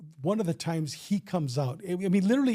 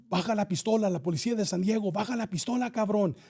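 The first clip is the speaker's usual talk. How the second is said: high, urgent mock yell in Spanish; retold yelled command; 4 semitones higher, faster than usual. Officer orders gunman to drop pistol, retold yell. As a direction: reenact a police order without full volume. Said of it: "I'm yelling at him".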